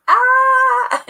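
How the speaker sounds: imitating a shocked friend's sudden cry; startled gasp. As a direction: yelp Ah like you've been caught off guard. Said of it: "She's like".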